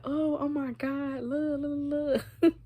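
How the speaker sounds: mock kid amazement at what's on screen; giddy shock. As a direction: kids hushing each other to look. Kids shocked at the tape.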